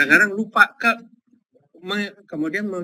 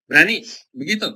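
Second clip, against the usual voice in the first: ordinary talk, nothing marked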